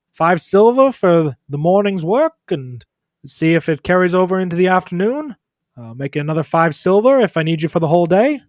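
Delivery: high, loud doctor voice; breezy, loud patter with a lifted pitch; doctor character. Acted. Doctor wrapping up a broken arm, high and loud. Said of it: "doctor looks over at you and says".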